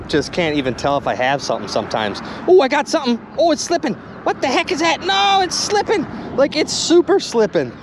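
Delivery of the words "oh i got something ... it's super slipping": high-pitched, urgent excitement; real high-pitched frustration and excitement; excited alarm. Real, not acted. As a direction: blurt it out high as the catch slips away. Magnet slips off a new find.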